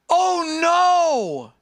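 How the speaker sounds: high, loud, dismayed oh no; shocked by what he sees; real alarm. Real, not acted. Streamer sees classmate seemingly in a wheelchair, alarmed.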